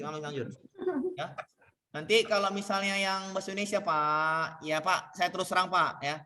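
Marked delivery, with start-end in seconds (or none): none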